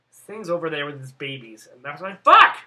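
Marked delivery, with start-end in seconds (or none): 2.2-2.6 very loud, high burst of swearing; frustrated shout. Retold or imitated. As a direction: yell it out in retold frustration. Retold frustration at missing the video.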